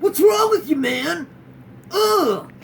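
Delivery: loud, high outraged yell; hammed-up indignation at your partner; hammed-up outraged shout; mock outrage.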